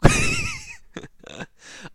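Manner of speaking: a sudden high squeal of a laugh. Real, not acted. GM cracking up at the player's escape plan, high squeal. GM reacts to dine and dash.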